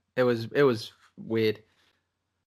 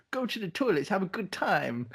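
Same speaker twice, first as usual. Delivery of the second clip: scumbag London guy voice; 7 semitones higher than usual. Retold or imitated. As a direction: play her as a dodgy London bloke. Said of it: "I don't know why she's got this voice".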